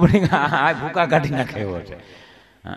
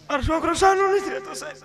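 much higher, softer fretting mother voice; worried mother; 13 semitones higher, 5 dB quieter than usual. Retold or imitated. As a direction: fret over the sick boy in a high voice.

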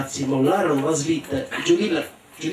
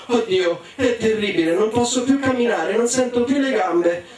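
lamento recitato che sale di tono; lamento gemente; 7 semitones higher than usual. Acted. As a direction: geme esagerando la propria disgrazia. (lamento acuto da finta malata)

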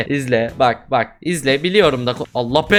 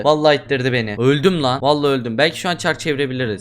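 ordinary delivery

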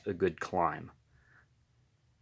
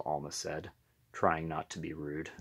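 plain reading voice

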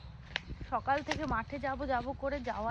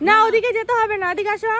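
calling out; 9 semitones higher, 15 dB louder than usual. Real, not acted. Calling child to come here.

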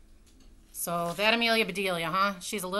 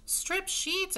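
shocked exclamation; 12 semitones higher than usual. Acted. Housewife shocked at the torn sheets, high exclamation. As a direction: pitch jumps way up in disbelief. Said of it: "exclaimed Missus Rogers".

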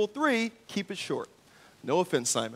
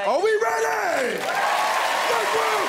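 hype shout; 11 semitones higher than usual. Real, not acted. Host hyping crowd.

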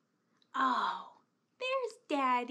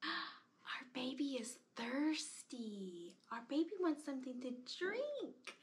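whispering; 5 dB quieter than usual. Pretend. Whispering over sleepy doll.

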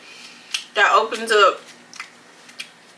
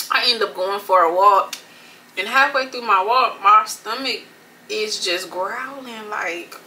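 chewing between words; talking around food; mouth partly full. Real, not acted. Seen 0: Bites at -4 and -1; sandwich held at mouth while talking.

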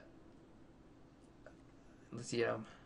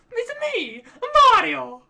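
high-pitched game-character catchphrase; Mario; 10 dB louder than usual. Acted. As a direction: squeal it out high, louder at the end.

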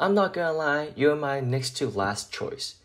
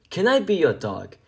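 plain reading voice

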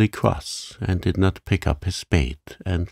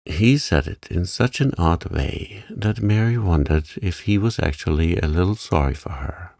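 usual speaking voice

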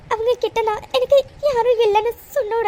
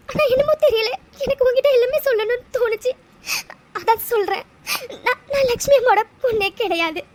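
speaking through tears, voice catching; tearful confession. Acted. Orphan telling a loved one her past, crying. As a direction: let the tears come as you confess the truth. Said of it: "அழாத".

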